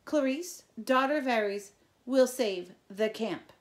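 slow, loud and high; proud loud vow. A narrator's reading. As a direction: proclaim it like an oath.

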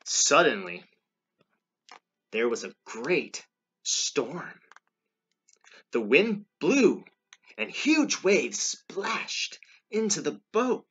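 loud, slow narration climbing high; dramatic storytelling. A narrator's reading. Reader tells children of the storm, dramatic narration. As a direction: build the storm, stretching each word. Reads storm scene aloud.

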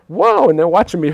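high, loud burst; whole block watching him; excited storytelling. Real, not acted. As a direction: lean into the drama. Crowd sees him dragged.

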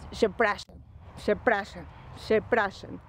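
przepraszam said slow, as shepra-sham; przepraszam, slowly, with Polish sh sounds; Polish word przepraszam. Shown as a demonstration. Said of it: "¿qué más?".

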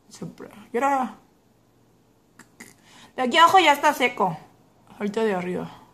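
speaking with finger on lip; finger touching lips. Real, not acted. Seen 1: Fingertip pressed on lower lip while speaking, testing lipstick dryness.

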